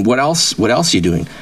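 ordinary talk

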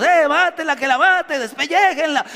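acted yell of an angry mob; imagined mob shouting. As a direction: shout like the crowd demanding her death. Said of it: "Yo pienso que fue a gritos".

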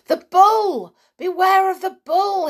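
loud, high-pitched warning yell; sudden yell. A narrator's reading. Julian pranks Cecil about a bull, yelling suddenly. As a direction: yell out as if danger is right there. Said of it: "he yelled suddenly".

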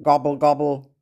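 lightly voiced turkey gobble; gobbling turkey voice. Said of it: "Let's hear turkey again".

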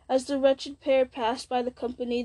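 plain reading voice

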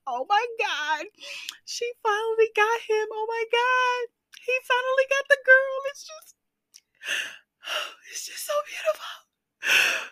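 high and rising into teary squeals, ending in a breathy sob; swooning over a Hallmark ending, voice cracking into tears; mock sappy crying. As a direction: gush over a movie ending until the voice breaks. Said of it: "the girl who watches the Hallmark movies and cry".

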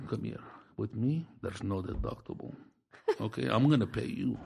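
very soft, low murmured offer; secret deal whisper. Retold or imitated. Shop guy offering a cash deal, in a low murmur. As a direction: keep it down like a shady deal.

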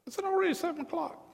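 high-pitched, quick surprised question; startled little squeak of a question. Real, not acted. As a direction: catch sight of the time, voice jumping up fast. Preacher reacting to the time.

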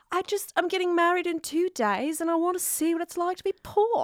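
high, soft mock-British princess; bad British accent. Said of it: "doing a really bad British accent".

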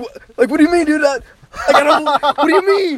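nervous laughing protest. Retold or imitated. Confused, laughing protest to dad.

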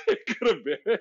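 half-laughing the words. Real, not acted. Agreeing with her friend while laughing. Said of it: "A Year in the Life (laugh)".

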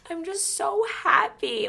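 high, slow, weepy whine; mock happy crying. Recalling herself drunk on her birthday, mock weepy. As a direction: drag it out as if tearfully overwhelmed. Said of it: "girls cry 'cause they're".

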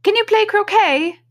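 very high, loud queen's question; shouted. A narrator's reading. Queen turning to Alice about croquet, shouting. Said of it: "shouted the queen".

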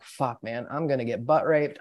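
hushed, retold dread; scared muttering. Frightened newcomer to himself, hushed mock dread. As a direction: drop the volume right down, as if dreading it privately. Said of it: "Look at how scared that guy is".

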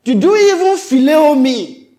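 pointed, forceful questioning; insistent Jesus. Acted. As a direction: press the question hard, high and loud. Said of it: "Jesus turned the screw again and said".

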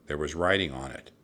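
plain reading voice

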